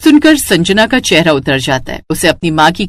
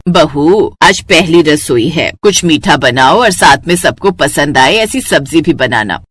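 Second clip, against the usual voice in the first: lightly voiced elder woman, lower pitch; instructing mother-in-law; 6 semitones lower than usual. Mother-in-law assigning the bride's first kitchen duty, kindly.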